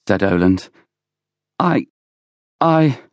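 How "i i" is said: high, faltering false starts; falter, unable to get going; frightened stammer. A narrator's reading. Oland tries to answer Villiers, stammering nervously.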